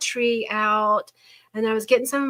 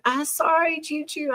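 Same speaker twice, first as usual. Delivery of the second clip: put-on higher-pitched little-girl apology; imitated apologetic grandchild; 7 semitones higher than usual. Hurt granddaughter to grandmother, apologetic child imitation. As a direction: lightly mimic a sorry child. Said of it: "And she's like".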